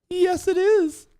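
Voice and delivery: very high, put-on DJ voice; hammed-up radio DJ. DJ recognized on the phone, hammed-up high voice. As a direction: answer in an exaggerated high DJ voice.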